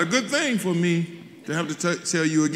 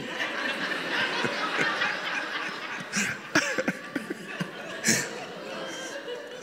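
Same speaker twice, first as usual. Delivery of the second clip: real laughter; 7 dB quieter than usual. Real, not acted. Long laugh at the story.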